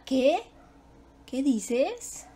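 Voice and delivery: "Qué dices" with a rising questioning end. Shown as a demonstration. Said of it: "que es una pregunta".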